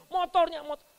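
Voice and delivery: high, loud mock scolding. Parent scolding a child who fell off the motorbike, loud mock anger. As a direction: yell about the motorbike, not the child. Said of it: "Kita marah-marahin".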